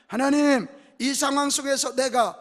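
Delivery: demonstrated fervent prayer; crying out in prayer. Shown as a demonstration. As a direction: call out to God forcefully. Models prayer calling out to God.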